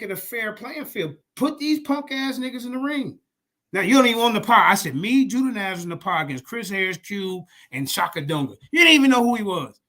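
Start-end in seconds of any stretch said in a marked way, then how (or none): none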